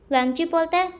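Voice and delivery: usual speaking voice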